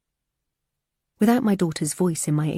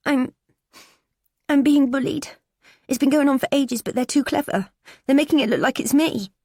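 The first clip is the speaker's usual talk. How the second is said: put-on high, strained teen voice on the verge of tears; a bullied girl pleading to be believed; tearful teenage girl; 9 semitones higher, 4 dB louder than usual. Daughter confiding bullying to mum, tearful and high.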